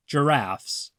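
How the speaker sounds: usual speaking voice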